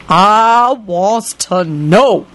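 slow, drawn-out menacing voice; ominous. A narrator's reading. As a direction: let the threat hang. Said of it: "she said ominously".

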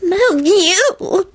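high, drawn-out arch flirtation. A narrator's reading. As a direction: coo it archly, very high and slow. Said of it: "she told him archly".

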